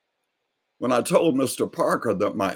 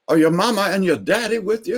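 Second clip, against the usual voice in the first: high, fast, louder question; old neighbor asking; 9 semitones higher, 4 dB louder, faster than usual. Retold or imitated. Neighbor asks Carlos about his parents, high quick question. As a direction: fire the question eagerly. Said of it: "he said".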